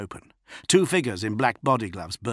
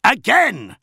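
higher, louder bellowed order; bellowing; 13 semitones higher, 10 dB louder than usual. A narrator's reading. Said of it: "bellowed Namet Jira".

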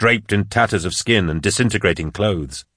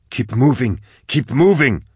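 urgent yell, rising on the repeat; acted cry to hurry; 4 semitones higher than usual. A narrator's reading. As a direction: urge them on with a restrained narrator's yell. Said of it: "Vorier yelled".

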